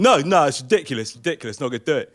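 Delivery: loud, indignant imitation of arguing passengers; mock shouted protest. Two guys refusing to leave the bus, shouting. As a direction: retell two men refusing loudly. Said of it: "two guys shouting".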